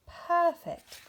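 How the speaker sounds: high, loud and pleased; delighted. A narrator's reading. Rabbit and Bear admire their snowman, delighted. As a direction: beam it out together.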